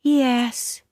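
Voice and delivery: put-on high childlike sad yes; a touch of sorrow, girlish; sad little girl. Anne admitting her hair is red, sadly. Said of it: "said the little girl sadly".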